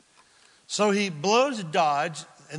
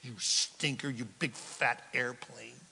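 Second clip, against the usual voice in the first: soft, lower, grumbling under breath; muttered thought; 5 semitones lower, 8 dB quieter than usual. Acted. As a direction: grumble it to yourself.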